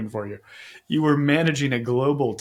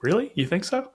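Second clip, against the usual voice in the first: put-on high, rising, doubtful kid; a kid who can't believe the praise; mock surprise; 8 semitones higher than usual. High school athlete surprised by coach's encouragement, mock surprised.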